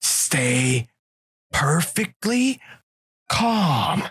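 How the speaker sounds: stretched, high, slow warning; mock calm order. Buddy over a spider on his chest, slow, high, mock calm. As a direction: draw out each word, soothing and tense. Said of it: "He was like".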